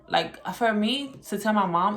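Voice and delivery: ordinary talk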